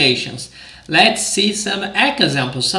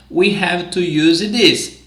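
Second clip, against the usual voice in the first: ordinary delivery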